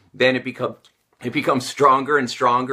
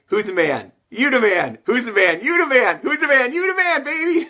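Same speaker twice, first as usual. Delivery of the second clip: hyped-up self pep talk; 10 semitones higher than usual. Shown as a demonstration. Coach hyping himself in the mirror, escalating excitement. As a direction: pump yourself up in the mirror, getting wilder each time. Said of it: "And I get all fired up".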